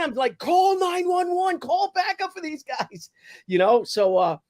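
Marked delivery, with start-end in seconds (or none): 0.4-3.1 high, slow, drawn-out holler; urgent calling out. Retold or imitated. Bystander asking people to get cops backup, urgent holler. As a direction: imitate calling to onlookers for help.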